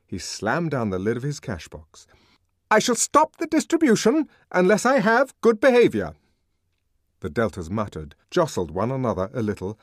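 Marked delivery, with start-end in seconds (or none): 2.7-6.1 high, loud official warning; irate, raised-voice ultimatum; angry threat. A narrator's reading. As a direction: threaten the unruly crowd at full voice. Furious official threatens the crowd.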